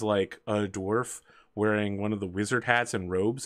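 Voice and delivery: ordinary talk, nothing marked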